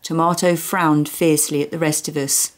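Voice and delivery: ordinary delivery, nothing marked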